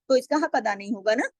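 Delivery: normal talking voice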